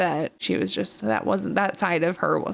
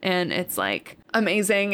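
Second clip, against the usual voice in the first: usual speaking voice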